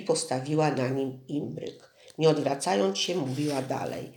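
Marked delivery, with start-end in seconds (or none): none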